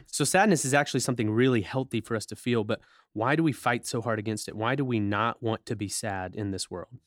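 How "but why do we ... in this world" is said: normal talking voice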